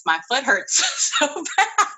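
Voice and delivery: slow, high quote broken by a laugh; giggling while retelling the plea; laughing through a quote. Retold or imitated. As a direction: laugh out the stranger's complaint. Stranger's plea, teller laughing.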